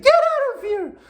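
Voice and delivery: high, shrieking stepmother yelling him out; angry stepmother. Retold or imitated. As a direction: screech it as the furious stepmother.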